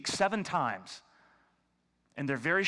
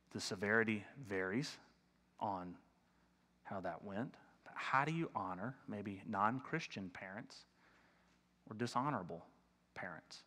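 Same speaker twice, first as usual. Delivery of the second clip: softer, lower, with long pauses; hushed reflection; 6 semitones lower, 9 dB quieter than usual. Real, not acted. Pastor gently raises a hard question to the church, hushed and low. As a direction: ask it gently, like a tender question.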